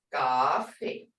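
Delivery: "coffee" as gaah-fee, long ah; adapted native pronunciation. Shown as a demonstration. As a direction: soften the c to g in "coffee". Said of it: "but we can say coffee".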